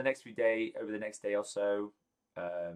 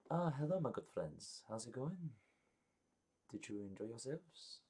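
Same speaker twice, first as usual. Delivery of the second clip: a lightly voiced host, soft and welcoming; gracious hostess; 2 semitones higher, 9 dB quieter than usual. Guild head welcoming the party back, soft and gracious. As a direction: greet them softly and graciously.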